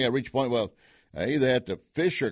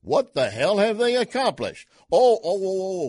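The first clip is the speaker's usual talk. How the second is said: indignant outburst; 7 semitones higher, 6 dB louder than usual. Real, not acted. Indignant rant.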